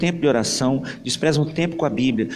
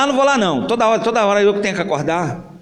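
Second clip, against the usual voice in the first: higher, louder sulky whine; whiny complaint; 5 semitones higher, 4 dB louder, faster than usual. Acted. Older brother complaining to his mother about always waking Joãozinho. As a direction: grumble that it's always your job.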